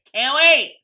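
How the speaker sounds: loud, high burst of eagerness; excited outburst. Real, not acted. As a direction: belt it out, thrilled and impatient.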